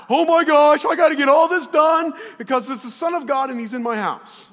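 hammed-up panic at full volume; high, loud, frantic flailing over unfinished work; freaking out. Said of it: "she's freaking out".

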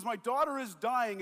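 loud, desperate plea of a father. Retold or imitated. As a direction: cry out that your child is dying. Desperate father pleads with Jesus.

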